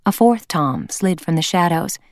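ordinary delivery

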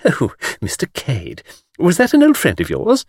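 high, skittish tourist voice; skittish young woman. A narrator's reading. Miss Taylor quizzes the tour guide, skittishly. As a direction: flutter up into a girlish question. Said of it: "the youngest and most skittish of the party".

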